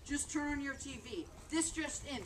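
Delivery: normal talking voice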